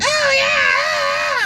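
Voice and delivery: long high mock shriek; imitated scream. Driver mimics passenger screaming a foot away, imitated shriek. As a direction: squeal it like an overexcited passenger, stretched out. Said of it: "You don't have to scream at me".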